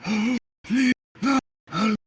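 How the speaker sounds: high, loud creepy begging; hammed-up panicked creep; pleading creep. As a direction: beg in a put-on eerie voice. Creep begs not to be reported.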